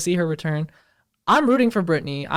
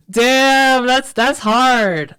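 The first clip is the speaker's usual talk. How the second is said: shocked; 5 semitones higher, 5 dB louder, much slower than usual. Real, not acted. Reacting to the memoir quote.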